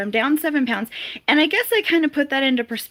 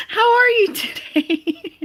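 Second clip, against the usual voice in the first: much higher, slow, laughing through it; laughing greeting; 10 semitones higher, 6 dB louder, slower than usual. Real, not acted. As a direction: greet them through giggles. Greeting a viewer while laughing.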